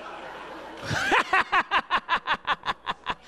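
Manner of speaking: high, long stuttering giggle; creepy sleep-talk laugh. Acted. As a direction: giggle eerily in your sleep. Acting a creepy staring sleep talker.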